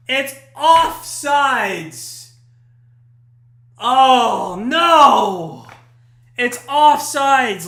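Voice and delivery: loud, long drawn-out cry of dismay; anguished call. Real, not acted. Commentator sees the US goal flagged offsides, anguished drawn-out cries. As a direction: stretch each word out in loud disbelief.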